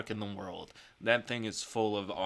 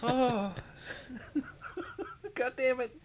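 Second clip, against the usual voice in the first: laughing; 12 semitones higher than usual. Real, not acted. Still laughing.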